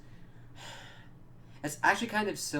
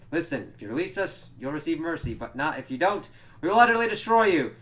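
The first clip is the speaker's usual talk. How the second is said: raised voice building to a high, loud peak; mock grand threat; 6 semitones higher, 9 dB louder than usual. Nandor threatens his captors, building to a loud threat. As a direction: build to a shout on the last sentence.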